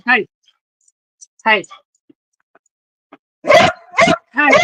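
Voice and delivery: sharp, high, loud hey; sharp scolding. Real, not acted. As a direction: bark it at the dog. Scolding the barking dog.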